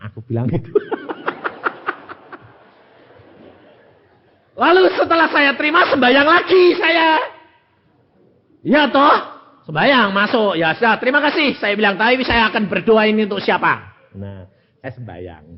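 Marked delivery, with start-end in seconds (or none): 0.8-2.4 light breathy giggle; high airy laugh. Real, not acted. Preacher laughing at his own story, airy and high. 4.5-14.0 1 excited laughing. Real, not acted.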